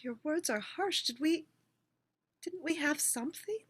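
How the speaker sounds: wounded ex pleading quietly; hurt woodpecker. Acted.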